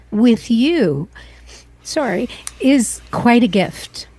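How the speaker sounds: slow, fighting back tears; trying hard not to cry, voice close to breaking; holding back tears. Real, not acted. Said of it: "I'm gonna try really hard not to cry".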